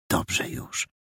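breathy whispered reply; hushed, voiceless whisper. Acted. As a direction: whisper so she won't hear. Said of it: "wyszeptał Perełka".

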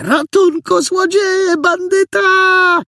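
very high, drawn-out alarm cry; unrestrained screaming. A narrator's reading. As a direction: let rip, stretching the last word. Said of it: "pofolgowała sobie". (alarmujące wołanie na cały głos)

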